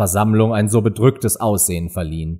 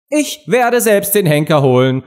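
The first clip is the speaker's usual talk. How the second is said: eifriger König; 6 semitones higher than usual. A narrator's reading. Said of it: "sagte der König eifrig".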